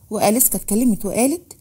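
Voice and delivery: ordinary delivery, nothing marked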